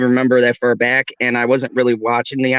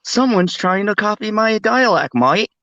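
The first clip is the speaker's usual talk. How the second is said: higher-pitched, hammed-up Aussie accent; mock Australian accent; 9 semitones higher than usual. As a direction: play an Australian catching a copycat, badly. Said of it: "Australian, uh, senses are going off. He's like".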